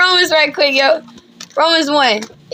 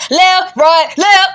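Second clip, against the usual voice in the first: loud drill-style cadence count; militant marching call; 2 semitones higher, 4 dB louder, much slower than usual. Acted. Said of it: "He's militant".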